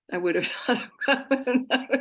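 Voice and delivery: words broken up by laughter; amused, laugh-choked telling; laughing while talking. Real, not acted. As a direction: struggle to get the joke out through giggles. Joking, breaking up with laughter.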